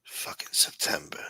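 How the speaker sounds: whispered curse. Real, not acted.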